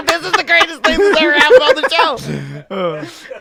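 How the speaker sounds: laughing while talking; words through real laughter. Real, not acted. Laughing at robocall.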